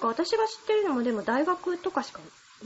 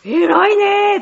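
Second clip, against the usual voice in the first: put-on louder, drawn-out praise to a child; cooing praise; 6 semitones higher, 10 dB louder, much slower than usual. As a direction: gush over a good kid. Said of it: "って言うもの".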